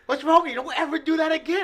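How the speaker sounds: scolding wife. Retold or imitated. Wife yelling at her husband after he passed out, loud and high. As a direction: shout at him, scared and angry.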